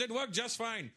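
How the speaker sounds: Indian dad. Retold or imitated. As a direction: insist it's fine, like a proud father. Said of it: "He goes".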